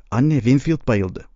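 ordinary delivery, nothing marked

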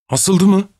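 mildly alarmed, high-pitched question; understated fearful exclamation; frightened cry. A narrator's reading. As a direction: read a startled cry with restraint. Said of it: "diye korkuyla bağırarak".

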